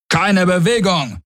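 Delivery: high, urgent halt order; guard's command. Acted. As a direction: call out like a guard making an arrest.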